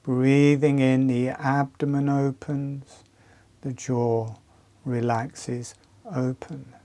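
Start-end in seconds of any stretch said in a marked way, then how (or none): none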